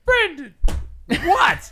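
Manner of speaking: high, loud name call and startled reply; mock yell. Reenacting sleep-talker yelling at his roommate, high loud mock yell. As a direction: shout it like waking someone.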